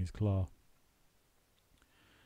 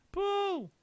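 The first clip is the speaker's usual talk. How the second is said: very high yell; very high yelled pull. A narrator's reading. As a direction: yell the order. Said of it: "yelled Mugglewump".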